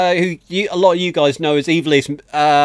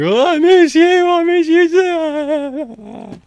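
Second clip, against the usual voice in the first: high, drawn-out fake crying wail; over-the-top tearful wail, stretched out; mock blubbering; 12 semitones higher, 3 dB louder, much slower than usual. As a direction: blubber down the phone, hammed right up. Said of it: "There's going to be crying".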